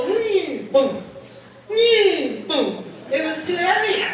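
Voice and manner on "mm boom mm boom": mock machine noise. Woman imitating the truck box failing to rise, mock hum and boom. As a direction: hum then a dropped boom, twice. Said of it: "and they go".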